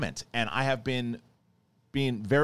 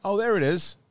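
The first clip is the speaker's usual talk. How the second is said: high, loud happy surprise; mock delight; 10 semitones higher, 6 dB louder than usual. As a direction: perk up as if spotting it. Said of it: "I'm like".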